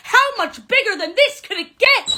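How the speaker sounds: high, loud flustered yelps; flustered panic. Acted. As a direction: caught red-handed, all in a fluster. Flustered caught by surprise.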